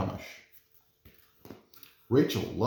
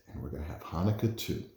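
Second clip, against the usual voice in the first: softer, lower confiding aside; with a wink; 6 semitones lower, 5 dB quieter, faster than usual. A narrator's reading. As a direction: add it softly, as if with a wink. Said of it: "Then she winked".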